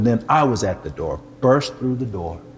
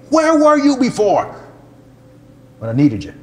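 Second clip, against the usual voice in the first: much higher, louder anguished rebuke; preaching cry; 13 semitones higher, 6 dB louder than usual. Retold or imitated. Preacher accusing God of absence, a loud anguished cry. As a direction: cry out at God, hurt and angry. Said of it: "Sorry, I'm preaching".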